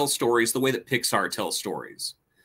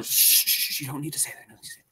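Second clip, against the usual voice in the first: whispered shushing, very soft; hushing whisper; 5 dB quieter, much faster than usual. Retold or imitated. Kids hushing little cousin, soft whisper. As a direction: hush a child so adults won't hear. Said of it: "So we tell her".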